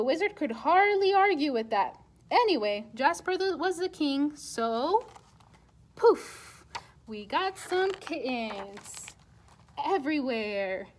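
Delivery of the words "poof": magic poof. A narrator's reading.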